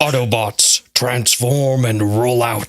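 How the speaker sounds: Optimus Prime impression. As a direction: boom it out like the robot leader. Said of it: "I don't even know if I could do it".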